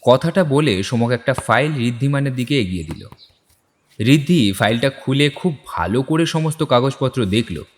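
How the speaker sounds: ordinary talk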